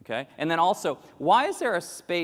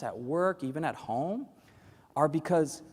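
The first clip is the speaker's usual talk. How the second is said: ordinary talk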